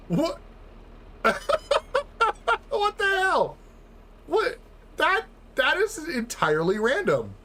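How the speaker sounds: high, incredulous "what the hell"; astonished. Real, not acted. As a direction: squeal in disbelief. Astonished at random pallet.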